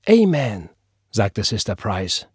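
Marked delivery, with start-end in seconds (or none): 0.1-0.7 high, loud assent; fervent amen. Acted. Church sister answering the scripture, fervent. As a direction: affirm it like a congregation answering a preacher. Congregational response to proclaimed scripture.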